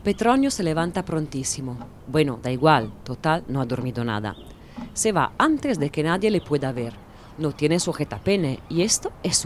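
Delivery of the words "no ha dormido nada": usual speaking voice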